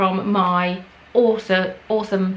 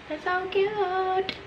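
high, softer cooing repeat; 10 semitones higher, 5 dB quieter, much slower than usual. Real, not acted. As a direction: gush quietly over something adorable. Repeating, gushing more softly.

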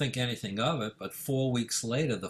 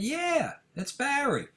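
much higher, delighted yes it's me; excited reply; slower than usual. Retold or imitated. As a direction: beam through the phone at an old friend. Answers old friend excitedly.

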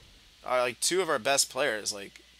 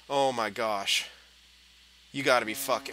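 ordinary talk, nothing marked

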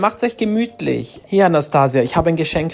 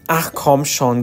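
usual speaking voice

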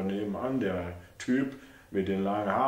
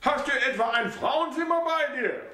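put-on much higher, prying old woman; high mother's voice, suspicious; 13 semitones higher than usual. A narrator's reading. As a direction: accuse him of hiding a woman.

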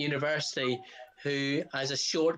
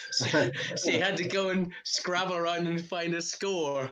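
laughing; 3 semitones higher than usual. Real, not acted. Laughing while telling anecdote.

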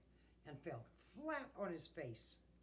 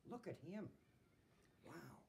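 ordinary delivery

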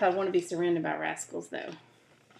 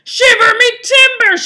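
huge, high pirate roar; pirate shout; 14 semitones higher, 16 dB louder than usual. Acted. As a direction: shout in shocked outrage as a pirate. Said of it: "shouted Pete".

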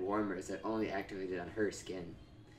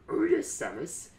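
villain voice; 5 semitones higher than usual. Acted. Ridley's note voiced by reader.

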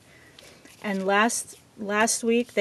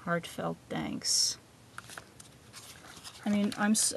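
normal talking voice

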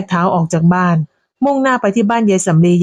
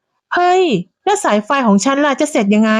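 higher, slow flustered protest; dismayed protest; 6 semitones higher, slower than usual. A narrator's reading. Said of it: "ก็อ้าปากค้าง".

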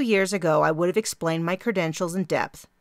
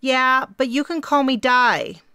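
raised, louder reply over the wind; lightly voiced Di; 8 semitones higher, 6 dB louder than usual. A narrator's reading. Di answers the girl outside, raised and louder. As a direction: call back through the glass.

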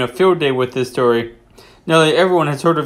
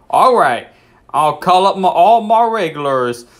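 a Southern "All right" with a bit more push; Southern accent; 2 semitones higher than usual. Acted. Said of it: "he has a more Southern voice".

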